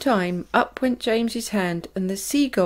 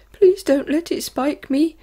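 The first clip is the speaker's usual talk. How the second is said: higher, pleading plea; begging; 7 semitones higher, 5 dB louder than usual. A narrator's reading. Earthworm begs James to protect him, frightened. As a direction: beg in fright. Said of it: "begged the Earthworm".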